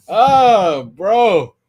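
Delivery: high, drawn-out holler; loud exclamation. Real, not acted. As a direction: wail it out loud, pitch swooping up and falling. Loud reaction to the manga.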